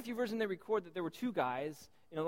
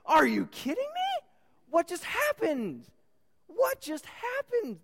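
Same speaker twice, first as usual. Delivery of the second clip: high, loud, drawn-out disbelief; incredulous outburst; 12 semitones higher, 7 dB louder, slower than usual. Acted. Preacher reacting to madman's surrender, incredulous. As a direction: exclaim it high and slow, utterly astonished.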